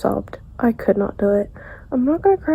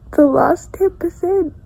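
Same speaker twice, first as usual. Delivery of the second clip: tearful; 7 semitones higher, 5 dB louder, slower than usual. Real, not acted. Upset after character death.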